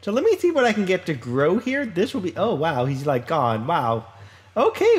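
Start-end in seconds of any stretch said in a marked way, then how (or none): 4.6-5.0 startled. Real, not acted. Friend suddenly gone.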